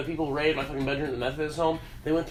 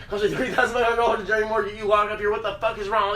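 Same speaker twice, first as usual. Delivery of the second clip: mock nagging mom; 5 semitones higher than usual. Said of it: "My mom's bitching at me".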